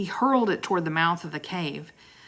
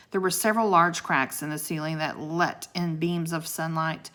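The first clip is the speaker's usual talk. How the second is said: plain reading voice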